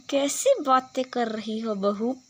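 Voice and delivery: normal talking voice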